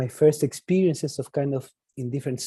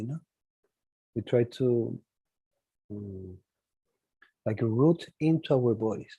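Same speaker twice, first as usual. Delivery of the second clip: soft, low meditation guide; guided meditation; 3 semitones lower, 4 dB quieter than usual. Acted. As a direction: ease them gently out of stillness.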